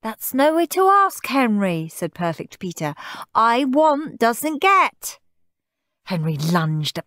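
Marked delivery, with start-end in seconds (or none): 0.0-1.9 high, goody-goody voice; high-pitched telling-off; prim little brother. A narrator's reading. Goody brother primly scolds. 3.3-5.2 high, slow sing-song lecture; goody-goody child preaching; prim little brother. A narrator's reading. Goody brother primly scolds.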